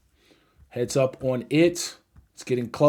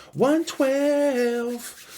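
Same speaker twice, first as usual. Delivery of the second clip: excited; 11 semitones higher than usual. Real, not acted. Calling out his sell price.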